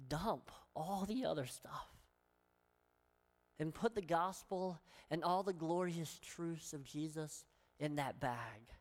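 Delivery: usual speaking voice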